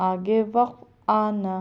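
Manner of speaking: usual speaking voice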